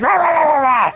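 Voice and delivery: high, loud mock "rah rah" ranting; mock angry ranting. Mocking the girlfriend's tantrum to a friend, high loud gibberish rant. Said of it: "And then she's like".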